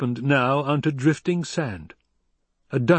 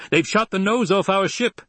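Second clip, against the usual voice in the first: calling out; 9 semitones higher than usual. A narrator's reading. Soldier calling out to everyone that the ship is hit. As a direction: shout across the chaos. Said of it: "someone called".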